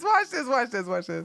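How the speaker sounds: ordinary delivery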